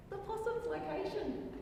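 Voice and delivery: high-pitched imitation of a zoo guide; broken English. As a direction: mimic a guide over-explaining to foreigners. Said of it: "broken, rather loud English".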